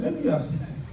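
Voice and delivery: ordinary talk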